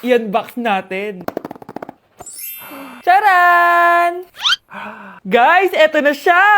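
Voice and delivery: loud, hyped-up unboxing voice; hammed-up influencer energy; excited vlogger. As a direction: present to followers with big drawn-out flourishes. Plays vlogger doing phone stress test.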